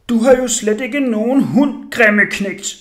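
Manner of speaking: loud, high-pitched scolding; angry shout. A narrator's reading. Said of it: "råbte jeg vredt".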